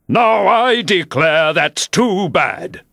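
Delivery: high, slow, indignant outburst; sudden passion. A narrator's reading. Humpty Dumpty flaring up at Alice, indignant. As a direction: flare up at her. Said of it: "breaking into a sudden passion".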